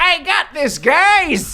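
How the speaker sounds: high, cocky "I got this" voice; Ben Beekeeper impression. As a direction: play the overconfident beekeeper hero. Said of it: "That's my best Ben Beekeeper impression".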